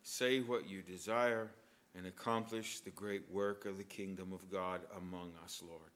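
hushed, low prayer voice; quiet reverent prayer. Acted. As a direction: pray softly before the congregation. Closing prayer.

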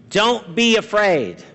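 ordinary talk

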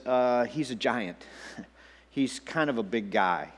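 low, soft, reluctant admission; hesitant reply. Acted. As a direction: sheepishly play it down, voice dropped. Everybody's hesitant reply to David.

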